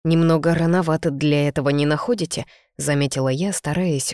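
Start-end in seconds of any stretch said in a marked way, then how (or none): none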